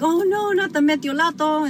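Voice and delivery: high, loud mock wail of a scared kid; a child pleading with mom, hammed-up mock dread; kid's dread. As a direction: whine it like a child seeing the stinging bottle. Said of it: "you would be like".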